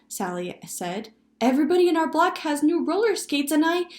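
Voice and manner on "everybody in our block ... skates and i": put-on high, loud, fast child butting in; child interrupting. A narrator's reading. Child competing for attention at family dinner, loud interrupting. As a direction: talk loudly over everyone as a complaining child. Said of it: "as each child interrupted, the talk became louder".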